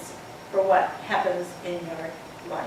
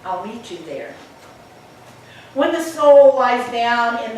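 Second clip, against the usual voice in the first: poem reading; 5 dB louder than usual. Acted. Speaker reciting a Rumi poem to the audience, measured and hushed.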